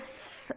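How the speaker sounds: स- as a long whistled hiss through joined teeth. Shown as a demonstration. Teacher showing the whistling seen sound. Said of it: "इस तरह की आवाज़ बीच में आनी चाहिए".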